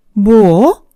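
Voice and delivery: loud, incredulous outburst; affronted. Acted. Mother hears girlfriend's sarcastic question, affronted. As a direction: throw it back in disbelief.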